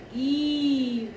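गी with a long drawn-out ee; long-vowel demonstration. Shown as a demonstration. Teacher shows children the big matra, long-drawn गी. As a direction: hold the vowel in गी. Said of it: "बड़ी की मात्रा का बड़ा उच्चारण".

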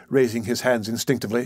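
ordinary delivery

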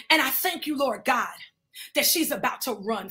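usual speaking voice

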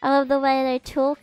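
ordinary talk, nothing marked

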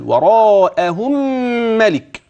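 وراءهم ملك with the mim joined into ملك through a held nasal; slow chanted وراءهم ملك with ghunna; tajweed nasalization. Shown as a demonstration. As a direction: hum the meem across into ملك. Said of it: "غنة التماثل".

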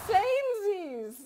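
high, slow, loud mock cheer; drawn-out shout. Streamer reacting to chat, drawn-out playful shout. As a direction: stretch Samesies into a long high call.

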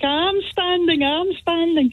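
mock shouting back. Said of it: "he's shouting back".

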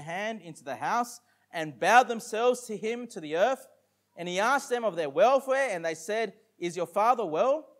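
loud, measured King James reading; scripture reading. Acted. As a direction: proclaim the verses to the congregation.

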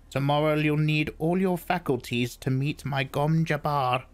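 high, ominous crone voice; old crone voice. Acted. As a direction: menace him like an old witch. Crone's parting threat.

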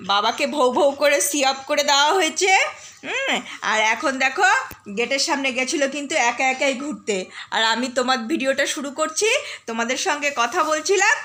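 ordinary delivery

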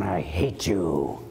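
mock grim declaration; mock hateful. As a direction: growl it out with contempt. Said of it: "It doesn't mean you go around".

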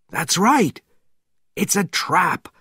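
high tense warning; urgent warning. A narrator's reading. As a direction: insist it's a trap. Conklin urgent repeat.

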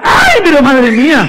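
high, loud, drawn-out cry; mock shock. Magician to child who undid the knot, mock shock. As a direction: exclaim in exaggerated alarm, slowly.

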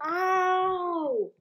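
sharp cry of pain. Real, not acted. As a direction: yelp from real pain. I just hurt myself.

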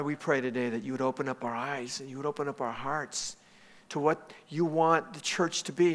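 soft, measured congregational prayer. Real, not acted. Pastor praying over the church before his message, soft prayer.

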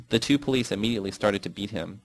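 usual speaking voice

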